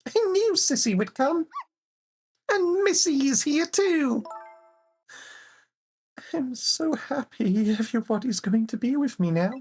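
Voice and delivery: put-on worried small girl, voice up high; a high, anxious child calling her friend; high little girl voice. Game character voiced in turns.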